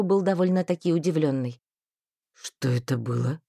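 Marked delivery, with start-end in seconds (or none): 2.3-3.4 just came to; dazed. A narrator's reading. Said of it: "заторможенно спросил он".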